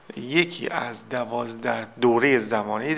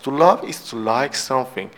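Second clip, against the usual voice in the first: slow model reading; 3 dB louder, slower than usual. Shown as a demonstration. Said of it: "teacher reads example definition".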